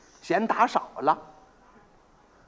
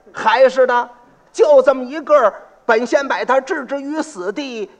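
put-on old man pleading, voice pitched up and louder; 6 semitones higher, 5 dB louder than usual. Old father begs the county magistrate, pleading.

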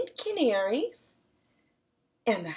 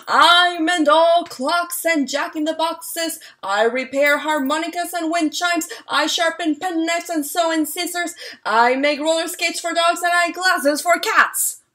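calling out; 6 semitones higher, 9 dB louder than usual. Acted. Strange man calling his wares through town, loud and sing-song. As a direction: call it down the street like a peddler. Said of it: "singing and calling".

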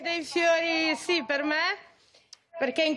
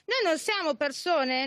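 ordinary talk

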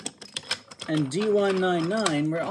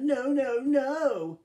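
higher, louder mock wail of no; 8 semitones higher, 5 dB louder, much slower than usual. D261 mocking Henry about the scrap heap, higher and taunting. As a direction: mimic Henry's sad no to tease him. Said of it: "mocked D two six one".

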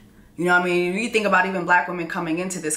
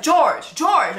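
calling after George; 6 semitones higher than usual. Retold or imitated. Queen calling to her husband, urgent high calls. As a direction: call him back, urgent. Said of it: "She's like".

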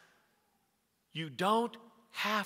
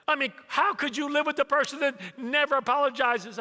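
high-pitched indignant question; exasperated son; 7 semitones higher, 3 dB louder than usual. Retold or imitated. Son asks dad how anyone copes, high and exasperated. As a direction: imitate a husband at wit's end. Son complains about wife.

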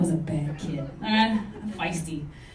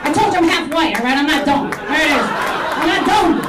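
defensive loud; 7 dB louder than usual. Real, not acted. Defensive after crowd reaction.

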